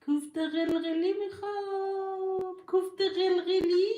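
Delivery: put-on teasing baby voice asking for meatballs; mock child whine. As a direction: whine it like a little kid. Mocking childish whining request.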